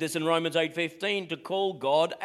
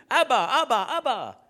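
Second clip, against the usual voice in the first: put-on bright, higher child-like calling of Abba; a loud, higher call of "Abba, Abba" with a laugh in it; children calling Abba; 5 semitones higher, 4 dB louder than usual. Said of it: "you will hear 'em saying".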